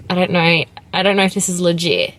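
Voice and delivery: ordinary delivery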